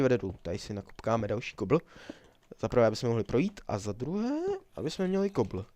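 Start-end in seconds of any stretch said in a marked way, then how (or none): none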